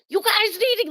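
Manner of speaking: high-pitched mock yell, no added volume; retold yelling. Dreamer warning sister and friend out of the water, retold yelling. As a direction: call out urgently, but at talking volume. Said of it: "I just kept yelling at my sister and my friend".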